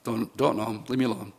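quiet, offhand mock denial; understated, dismissive mumble; brushing it off. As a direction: toss it away under your breath, acting a brush-off. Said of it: "he just blew it off".

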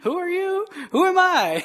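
God throws it back with a grin; mock God retort. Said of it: "God looking down and be like".

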